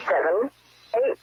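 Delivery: ordinary delivery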